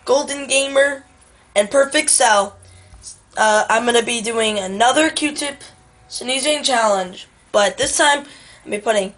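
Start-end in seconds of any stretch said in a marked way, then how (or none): none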